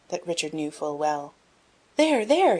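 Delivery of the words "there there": high, loud shout of glee; gleeful shout. A narrator's reading. Boy at the window spotting the banners, shouting with glee. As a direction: point and shout with delight. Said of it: "he shouted aloud with glee".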